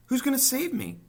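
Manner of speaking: very high, loud anxious plea; desperate question. A narrator's reading. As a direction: ask with desperate hope. Wilbur begging for rescue.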